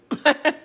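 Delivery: quick surprised laugh; short laugh. Real, not acted. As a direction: laugh at the heat. Reacting to hot salsa taste.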